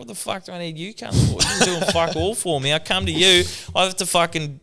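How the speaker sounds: high, indignant mock rant; Dad's rant. Dad telling off the specialist, in a high, indignant mock rant. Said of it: "he's like".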